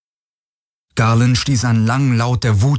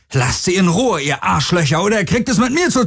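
much higher furious threat, fast at the end; angry; 9 semitones higher than usual. A narrator's reading. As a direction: yell the threat through the door. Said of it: "Und dann wütend".

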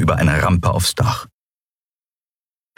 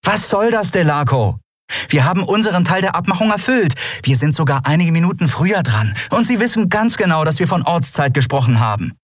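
deutlich höher, aufgebracht und drängend; empörte Kapitänin; 11 semitones higher than usual. Acted. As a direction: wirft ihm Wortbruch vor. Endriel empört sich. (Endriel zu Delarco, empört und aufgebracht)

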